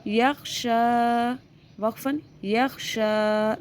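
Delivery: यख्शा with शा held long and level, both times alike; stretched recitation drill. Shown as a demonstration. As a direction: stretch शा equally before and after वक्फ़न. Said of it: "वक्फ़ में भी वैसा ही पढ़ा". (यख्शा का शा दोनों बार खींचकर)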